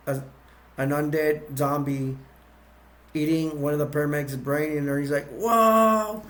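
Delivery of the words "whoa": high, drawn-out startled whoa; mock-startled cry, pitched well up; acted shock. Mortician finds zombie eating a brain, acted shock.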